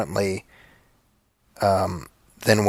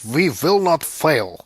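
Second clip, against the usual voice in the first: mock Russian accent; 4 semitones higher, slower than usual. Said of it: "Harrison Ford in K nineteen is like".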